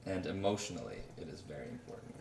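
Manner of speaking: whispered playful aside; joking whisper. Acted. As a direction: drop to a whisper for the joke. Joking aside about mustard.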